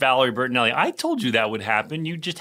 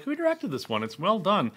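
mock curious self; 7 semitones higher, 4 dB quieter than usual. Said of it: "and I'm like".

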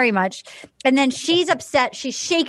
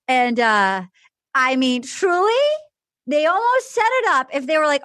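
ordinary talk, nothing marked

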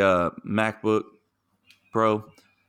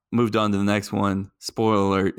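usual speaking voice

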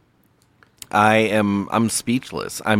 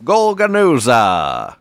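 high call, slow drawn-out name; train conductor; 9 semitones higher, 4 dB louder, slower than usual. Pretend. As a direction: announce the stop like an old-timey conductor.

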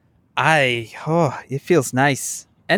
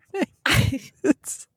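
laughing; 10 semitones higher than usual. Real, not acted. Laughing, fast and high.

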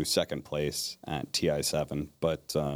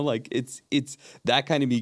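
ordinary delivery

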